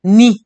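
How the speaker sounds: NI sounded alone, loud and punchy; stressed syllable. Shown as a demonstration. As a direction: hit "ni" hard as the stressed syllable. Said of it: "la sílaba tónica es".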